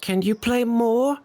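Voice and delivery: put-on very high child voice; little boy. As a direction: plead in a small boy's high voice. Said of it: "The little boy looks up at you".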